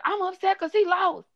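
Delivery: high whiny mock complaint; imitating the coworker's pouting wail; upset coworker. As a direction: whine it like a sulky grown woman. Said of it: "she's like".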